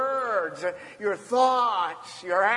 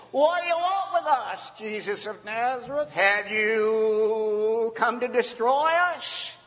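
worried demon; 3 semitones higher than usual. Acted. Demon confronts Jesus of Nazareth, alarmed cry. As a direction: wail it in alarm, stretching the words. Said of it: "The demon had just expressed concern".

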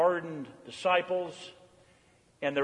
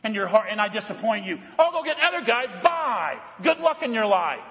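higher, mock-angry telling-off of fools; exasperated rebuke; 6 semitones higher than usual. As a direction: play a fed-up master storming off. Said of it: "That's what you and I would have done".

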